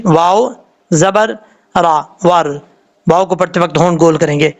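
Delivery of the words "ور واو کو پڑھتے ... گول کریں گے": usual speaking voice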